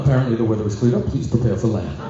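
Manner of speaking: pilot announcement. Retold or imitated. Pilot announces over the intercom.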